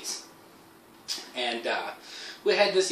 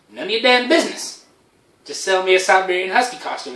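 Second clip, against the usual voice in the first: pushy, loud, higher demand; mock snap; 5 semitones higher, 5 dB louder than usual. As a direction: snap at the shopkeeper to just sell it. Snapping at clerk.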